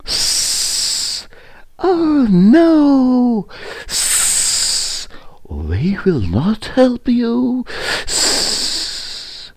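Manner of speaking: long hisses between high, loud words; high snake voice with drawn-out sibilant hisses; hissing snake. A narrator's reading. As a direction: refuse as a snake, hissing before and after. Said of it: "he heard the hissing of snakes".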